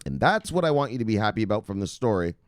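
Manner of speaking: ordinary talk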